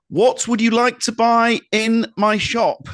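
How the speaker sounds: high, slow, loud projection; shouted stage line. Acted. Comedian repeats her line to the heckler, slow high shout. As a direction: shout it slowly so the back row hears. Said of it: "And so she shouted".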